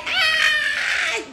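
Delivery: very high short shriek; excited scream. Pretend. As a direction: let out a high squeal. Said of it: "you're like".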